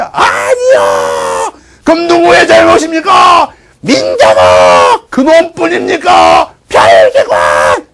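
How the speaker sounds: loud, drawn-out shouted questions and answers; rally call-and-response. Acted. Brothers and villagers naming culprits, rally call-and-response. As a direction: roar like orator and crowd together. Orator and excited crowd trade shouts.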